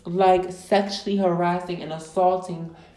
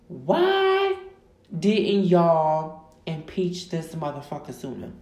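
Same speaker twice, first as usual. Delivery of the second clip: exasperated outburst; 4 dB louder, slower than usual. Real, not acted. Podcaster demanding answers from Congress, exasperated outburst. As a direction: cry out the why, then slow down.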